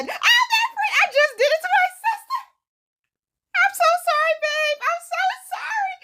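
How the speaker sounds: high-pitched, giggling guilty apology; laughing confession. Real, not acted. Owning up to her sister, laughing hard and high.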